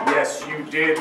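a quietly menacing gentle reply. A narrator's reading. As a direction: say it gently as it closes in. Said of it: "said very gently".

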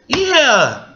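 ordinary talk, nothing marked

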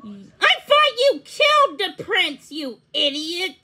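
high, loud outraged rebuke; exasperated yell, dropping on the insult; angry scolding. A narrator's reading. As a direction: berate a useless henchman, starting high. Toad thumps and scolds the beetle.

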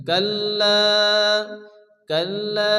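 "কাল্লা" with long held "লা"; teacher's drawn-out "কাল্লা"; elongated vowel. Shown as a demonstration. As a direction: chant "কাল্লা", stretching the end. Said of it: "এক আলিফ পরিমাণ টান দিয়ে".

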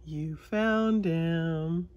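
plain reading voice

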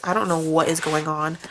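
ordinary talk, nothing marked

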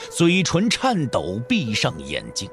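ordinary delivery

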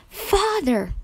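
high, overjoyed shout of Father; joyful cry. A narrator's reading. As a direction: cry out in sudden delight. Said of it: "the girls cried".

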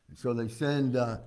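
ordinary delivery

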